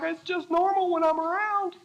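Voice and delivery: lightly voiced, offhand God; high, breezy no-big-deal God voice; casual shrug of a God line; God's casual reply. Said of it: "And God's like".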